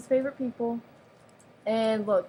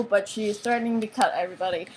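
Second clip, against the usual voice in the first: ordinary talk, nothing marked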